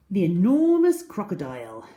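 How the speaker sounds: a storyteller's big announcement; title read. Acted.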